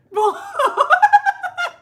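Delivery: high, loud squealing laugh; real laughter. Real, not acted. Fan laughing at the show, squealing laugh. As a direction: let the laugh burst out.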